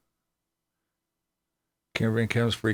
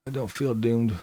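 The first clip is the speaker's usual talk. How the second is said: ordinary delivery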